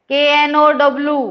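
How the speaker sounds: ordinary talk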